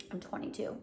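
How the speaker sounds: whispered joking lie; whispered aside. Acted. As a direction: whisper it as a secret.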